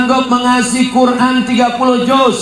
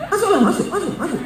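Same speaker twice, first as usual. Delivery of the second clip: mock taunt; 10 semitones higher, 5 dB quieter, much faster than usual. Preacher taunting the widow.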